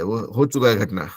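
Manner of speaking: ordinary talk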